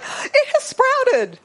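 high, slow, amazed "It has sprouted"; breathless delight; awed wonder. Retold or imitated. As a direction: marvel at it like a child. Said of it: "But it's like".

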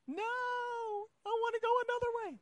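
put-on high, quick whiny child voice; a stubborn kid refusing. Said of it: "child voice reprised".

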